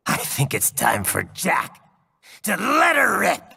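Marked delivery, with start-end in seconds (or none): 2.4-3.4 higher, loud cry building to a roar; battle cry. Acted. Jack showing off his nickname, loud battle cry. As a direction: unleash it with a roar. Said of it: "why don't I give you a demonstration?".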